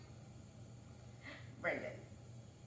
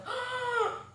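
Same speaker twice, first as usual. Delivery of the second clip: mock shocked gasp; 13 semitones higher than usual. Diners react to his comment.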